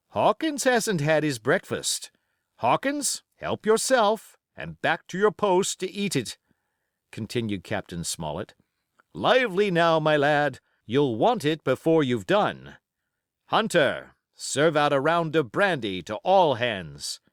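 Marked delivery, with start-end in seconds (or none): 0.1-6.4 higher, louder commanding captain's voice; a ship's captain barking orders to his crew; stern sea captain. A narrator's reading. 9.1-17.2 higher, louder commanding captain's voice; a ship's captain barking orders to his crew; stern sea captain. A narrator's reading.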